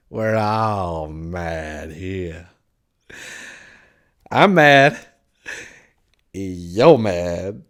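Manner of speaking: drawn-out low start, then loud bursts; mad Cheshire Cat. A narrator's reading. Cat declaring everyone mad, slow then loud. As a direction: stretch it slowly, then punch each short claim.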